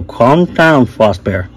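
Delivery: ordinary delivery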